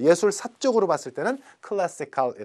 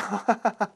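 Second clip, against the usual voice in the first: brief higher-pitched chuckle; quick burst of giggling; short laugh; 5 semitones higher than usual. Real, not acted. Teacher amused by underwear slang, short chuckle.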